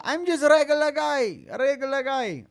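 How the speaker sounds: mock the champ's humble foreign accent in a squeaky high voice; self-admittedly bad high-pitched fighter accent impression; Merab accent. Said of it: "That's the worst Merab accent ever".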